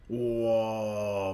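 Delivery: low, drawn-out whoa; sustained low groan of shock; long whoa. Real, not acted. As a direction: stretch out a deep spectator's whoa. Reacts to a player getting nailed.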